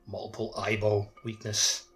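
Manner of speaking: normal talking voice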